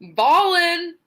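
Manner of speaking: high, loud mock call-out; imitated catchphrase call. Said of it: "M V P just goes".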